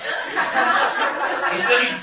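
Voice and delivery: ordinary talk, nothing marked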